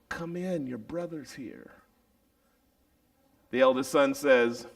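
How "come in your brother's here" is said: soft pleading invitation; gentle coaxing. Acted. Father to pouting son outside, soft and coaxing. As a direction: coax him in gently.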